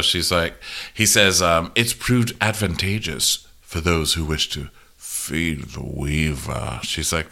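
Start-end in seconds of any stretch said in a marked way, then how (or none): 1.7-6.8 cryptic murmur. Retold or imitated. Larys voiced cryptically.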